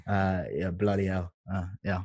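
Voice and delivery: low, soft British Cockney chat; Cockney accent. Shown as a demonstration. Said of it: "Cockney, Cockney accent".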